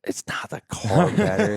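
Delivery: breathless, voiceless laugh through the words; laughing protest. Real, not acted. Teasing a friend about a heavy battery, laughing. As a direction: object while cracking up.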